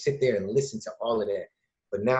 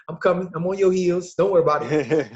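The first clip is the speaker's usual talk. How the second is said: playful excited brag; 8 semitones higher, 4 dB louder than usual. Retold or imitated. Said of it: "I'm like".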